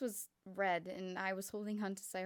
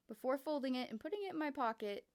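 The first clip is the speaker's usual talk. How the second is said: ordinary delivery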